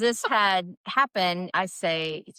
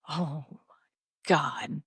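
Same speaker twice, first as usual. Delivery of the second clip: low, heavy mock dread; 5 semitones lower, slower than usual. Practitioner summoned to the ophthalmologist's call, low dread. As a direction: sink into it with long pauses.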